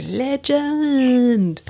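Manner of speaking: Legend in a broad Australian accent, high. Shown as a demonstration. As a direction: say Legend the Aussie way. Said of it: "say it like we say it in Australia".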